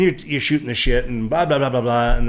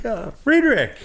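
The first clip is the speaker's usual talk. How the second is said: retold greeting; 12 semitones higher, slower than usual. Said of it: "I'm like".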